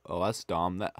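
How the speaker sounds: usual speaking voice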